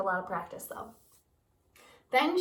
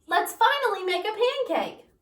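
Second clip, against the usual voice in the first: eager Jack; 10 semitones higher, 6 dB louder than usual. Acted. Eager boy voiced in story.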